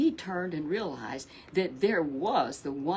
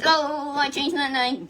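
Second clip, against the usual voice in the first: loud high mock reply; 7 semitones higher, 8 dB louder, slower than usual. Mock imagined reply to king.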